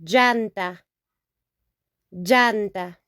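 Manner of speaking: Llanta with ll like a y; teacher modelling Llanta; Spanish double L. Shown as a demonstration. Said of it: "And now we have tire".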